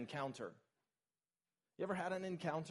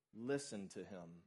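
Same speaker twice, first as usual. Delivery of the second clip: hushed command; 7 semitones lower, 8 dB quieter, slower than usual. Retold or imitated. God's words, hushed.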